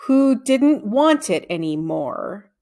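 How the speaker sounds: slow, careful answer, every word drawn out; slowly. Acted. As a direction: hold each word back, very slow. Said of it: "said Jeff slowly".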